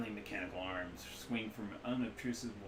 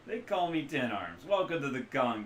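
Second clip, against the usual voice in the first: put-on Midwestern accent; 5 semitones higher, 7 dB louder than usual. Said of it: "loose Midwestern accent".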